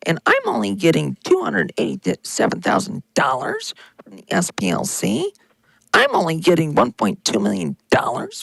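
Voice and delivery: mock him as a whining martyr who did everything; Nick Fuentes impression. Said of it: "I'll do a great impression here for you".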